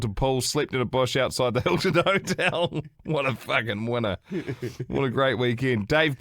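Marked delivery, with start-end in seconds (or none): none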